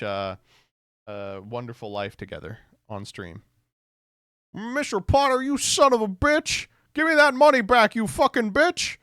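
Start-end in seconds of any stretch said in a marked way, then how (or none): 4.5-9.0 high, loud movie-hero outburst; an over-the-top raging George Bailey; furious yell. Acted. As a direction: scream it at the villain. Acted movie quarrel.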